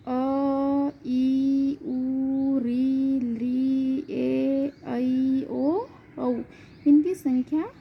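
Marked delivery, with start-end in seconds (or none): 0.1-6.4 अ through औ, each one sustained on a level note; prolonged vowels. Shown as a demonstration. Teacher showing students three-beat pluta vowels, each stretched long. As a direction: hold every vowel from अ to औ extra long. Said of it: "इनमें तीन मात्राओं का उच्चारण काल होता है".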